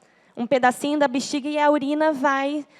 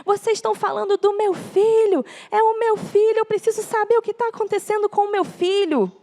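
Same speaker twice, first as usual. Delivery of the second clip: high-pitched, insistent imitation of a shouting father; retold shouting; 7 semitones higher than usual. Retelling husband demanding news of his son from doctors, mock shouting. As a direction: raise the pitch as a pleading, yelling dad. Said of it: "lá na frente gritando".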